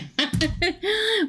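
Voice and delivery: high peal of laughter; cracked-up laughter. Real, not acted. Reader cracked up by Mary, high laughing fit. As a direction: burst out laughing mid-thought. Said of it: "you crack me up".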